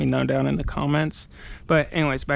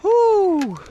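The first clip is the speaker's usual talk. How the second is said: high, loud whew from the heat; fanning oneself; 6 dB louder than usual. Real, not acted. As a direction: blow out a hot, winded whew. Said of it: "it's hot".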